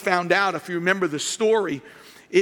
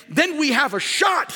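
emphatic; 6 semitones higher than usual. Real, not acted. Preacher stressing counseling point.

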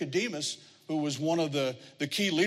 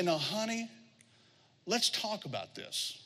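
soft, higher motherly coaxing; gentle coaxing mother; 4 semitones higher, 5 dB quieter than usual. Retold or imitated. Mother soothing child in story.

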